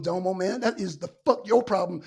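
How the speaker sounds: ordinary talk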